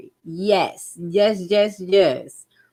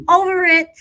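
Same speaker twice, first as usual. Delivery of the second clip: exasperated; 12 semitones higher, 7 dB louder than usual. Retold or imitated. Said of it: "I'll be like".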